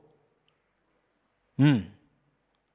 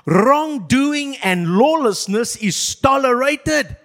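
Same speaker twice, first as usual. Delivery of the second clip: slow, louder, weighty proclamation; dramatic reading; 6 semitones higher, 4 dB louder, slower than usual. Shown as a demonstration. Pastor reading an ancient lament as headline, slow and loud. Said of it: "I'm gonna read some statements".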